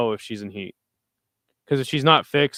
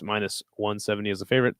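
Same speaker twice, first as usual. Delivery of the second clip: ordinary talk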